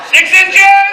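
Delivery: high, loud interjection; called-out warning. Real, not acted. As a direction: call it out sharply to the actors. Director warns embracing actors.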